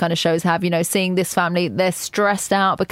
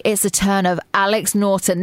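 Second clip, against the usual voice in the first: ordinary delivery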